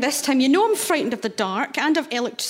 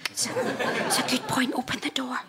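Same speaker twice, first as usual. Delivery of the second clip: amused little whisper; 6 dB quieter than usual. Acted. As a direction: whisper it with a hint of laugh. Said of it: "why are we whispering".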